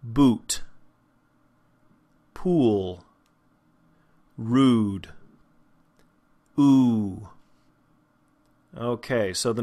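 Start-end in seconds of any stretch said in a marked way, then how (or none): 0.0-5.1 "Boot", "Pool", "Rude" with a long rounded oo; careful isolated words, pure oo in "Boot", "Pool", "Rude"; closed oo vowel. Shown as a demonstration. Teacher gives example words, tight oo in "Boot", "Pool", "Rude". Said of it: "words that go along with this sound". 6.6-7.3 long, tightly rounded "Ooh"; closed back vowel. Shown as a demonstration. Teacher repeats the vowel for learners, pure rounded "Ooh". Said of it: "go along with this sound".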